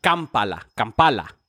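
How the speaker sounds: Kampala said clearly, each syllable shown; Kampala, hard K at the start, said as it sounds; careful Kampala for the quiz; spelled-out Kampala. Shown as a demonstration. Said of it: "Con K al principio y como suena".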